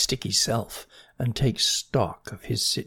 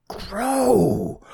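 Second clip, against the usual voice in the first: high terrified yelp; frightened cry; 10 semitones higher, 4 dB louder than usual. Acted. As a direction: cry out in sudden fear. Snail spots the crow.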